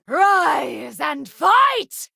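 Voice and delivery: high, slow, shouted rally; rally the fallen ally with a shout; drawn-out combat shout; battle cry. Acted.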